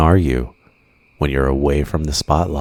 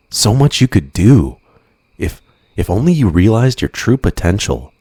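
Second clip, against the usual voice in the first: higher and louder, swept up in the pitch; growing fervour; 6 semitones higher, 3 dB louder than usual. Acted. Villain urging the hero to give up, rising fervour. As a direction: sell the dream, rising eagerly.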